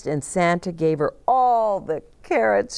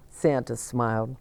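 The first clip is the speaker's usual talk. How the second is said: ordinary delivery, nothing marked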